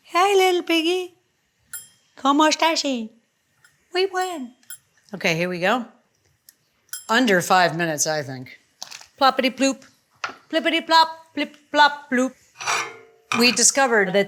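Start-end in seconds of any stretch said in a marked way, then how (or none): 0.1-4.5 high cooing voice for a toy pig; squeaky, playful baby talk; cute high voice. Pretend. Talking to a pig timer. 9.2-12.3 high, playful plop noises; bouncy, sing-song plips and plops; dripping water sounds. Pretend. Imitating food dropping in water.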